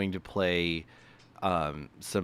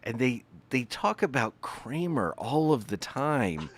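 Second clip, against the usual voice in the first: mock baffled TV host; 5 semitones higher, 3 dB louder than usual. Mocking Today Show hosts on air.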